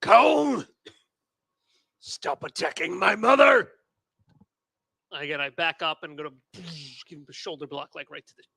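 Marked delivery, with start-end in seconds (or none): 0.0-3.7 loud, high protesting yell; in-character shout. Acted. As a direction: shout at an ally to stop attacking. Player shouts in character at Cone.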